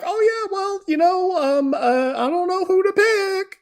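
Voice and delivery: very high, dithering silly voice; mock undecided voter. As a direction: dither in a squeaky, put-on falsetto. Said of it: "We're supposed to be like".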